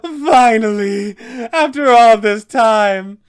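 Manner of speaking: slow, high, loud triumphant shriek; exultant vampire scream. Acted. As the players open the cellar, the vampire screams in triumph. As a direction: savor the release after a year locked up. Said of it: "Doru will scream out".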